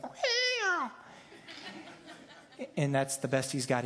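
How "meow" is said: a squeaky, very high little meow; tiny kitten meow. Retold or imitated. Pastor telling the Lion King scene, playing Simba's tiny squeaky meow. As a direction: Simba trying to roar but only mewing, very high. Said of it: "And he's like".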